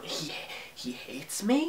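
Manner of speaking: soft rising hurt whisper; hurt question. Retold or imitated. Cat hurt by the dog's words, soft questioning. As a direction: quietly ask in disbelief. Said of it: "Karate Cat thinks".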